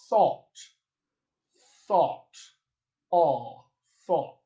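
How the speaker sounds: "thought" with a rounded back "aw"; General American vowel. Shown as a demonstration. Coach giving General American examples, rounded vowel in "thought". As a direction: isolate the rounded "aw" inside "thought". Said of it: "exists in the General American accent".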